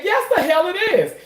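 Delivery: high, gleeful retort to the doctor; excited retort. Retold or imitated. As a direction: fire back at the doctor, tickled. His own retort, quoted excitedly.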